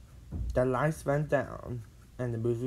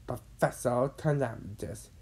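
ordinary delivery

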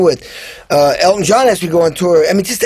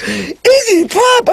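amazed disbelief; much slower than usual. Retold or imitated. Drummer hearing the [name] offer, high stretched amazement. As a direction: squeal it like you can't believe your luck.

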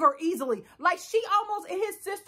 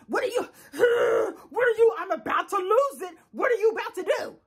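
mock panic; 4 semitones higher than usual. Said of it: "So the sister's like".

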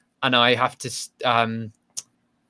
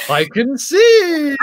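high, loud, drawn-out strain; hammed-up strained cry, pitched way up; exaggerated straining to see; 14 semitones higher, 6 dB louder, much slower than usual. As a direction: stretch it out as if struggling to see. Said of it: "look like I was straining".

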